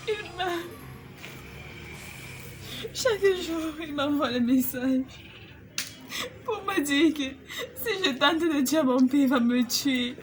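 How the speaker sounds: halting, sob-broken confession; crying. Acted. Girlfriend recounting her uncle's death threats, sobbing. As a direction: struggle to speak through sobs.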